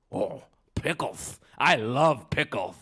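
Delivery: slow, loud, gleeful goblin. Acted. Goblin offered pickles by Herschel, slow greedy goblin voice. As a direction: relish each word as a pickle-mad goblin. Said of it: "said the goblin".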